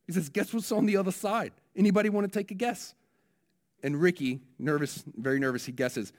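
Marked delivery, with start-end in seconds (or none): 0.3-3.0 higher Kiwi minister quizzing the mourners; playful New Zealand preacher asking the crowd; New Zealand accent. Retold or imitated. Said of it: "I'm gonna try my New Zealand accent here".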